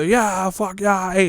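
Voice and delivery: high, slow mocking British cheer; mock British fan. A mocking vlogger imitating hyped British fans, high-pitched British cheer. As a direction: jeer like a drunk British boxing fan.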